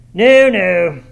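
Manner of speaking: loud, high, rude "no, no"; blunt, booming rejection; unmannerly refusal. A narrator's reading. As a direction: cut the guest off with a loud refusal. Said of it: "said the unmannerly wretch".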